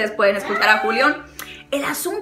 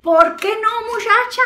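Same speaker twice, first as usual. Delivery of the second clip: sassy high tease; 9 semitones higher than usual. Acted. Sassy pep talk to the listeners.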